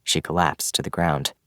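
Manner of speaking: ordinary delivery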